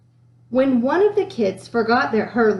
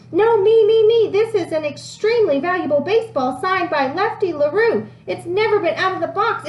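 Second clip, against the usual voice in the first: put-on clamoring kids; 7 semitones higher than usual. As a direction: shout over each other to be heard. Whole class clamoring for attention.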